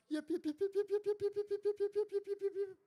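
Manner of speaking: rapid string of very high puppy yelps; terrified dog yips. A narrator's reading. Terrified pooch scampers off, yipping. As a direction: yip frantically on one squeaky high note. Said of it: "the terrified Pooch scampered off".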